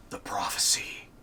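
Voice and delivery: breathy whisper; whispered title. Retold or imitated. Naming a favorite moment to his co-host, in a whisper. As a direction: hush it like a secret.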